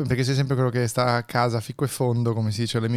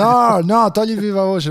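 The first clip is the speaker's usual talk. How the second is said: urgent; 9 semitones higher, 7 dB louder than usual. Real, not acted. Urgent call to drop speakerphone.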